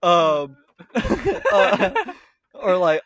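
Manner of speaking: normal talking voice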